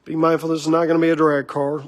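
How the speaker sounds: ordinary delivery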